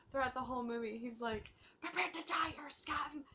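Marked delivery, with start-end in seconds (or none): 1.8-3.2 high-pitched pretend invader threat; mock alien threat. Fan quoting the alien invader, mock menacing. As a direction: put on a small, high alien voice.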